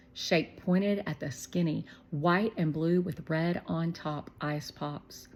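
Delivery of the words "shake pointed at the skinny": ordinary delivery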